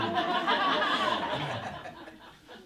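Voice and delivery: high, loud burst of laughter; a real loud laugh at her own joke. Real, not acted.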